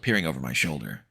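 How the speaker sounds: ordinary delivery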